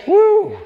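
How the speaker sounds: high, loud "woo"; high exultant whoop; excited whoop. Real, not acted. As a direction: whoop with delight at the point just made. Preacher whoops between points.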